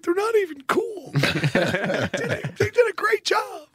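high, laughing grudging voice; laughing mock grudge. Host admitting the wedding video got him, high and laughing. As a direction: squeak out the grudging praise through laughter.

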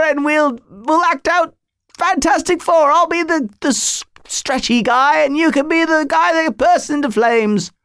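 put-on high-pitched boyish British accent; imitated British accent. Boy bidding his comic-book friend goodbye, British accent. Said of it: "he would say it in his British accent".

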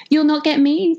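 high piggy voice crying out defiantly. Acted. Little pig taunting the wolf, high cry. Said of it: "the piggy cried".

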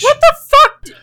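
high, loud disbelieving squawk; shocked outburst. Real, not acted. A podcast host reacting to a crude confession with a shocked squawk.

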